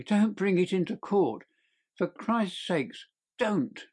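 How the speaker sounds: high, frantic begging; desperate pleading. Acted. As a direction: plead like a caught man terrified of court. Thief begging Holmes for mercy.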